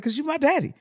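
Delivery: high, laughing, pleading voice through giggles; laughing high plea. Retold or imitated. To her father at the morgue, a high laughing plea.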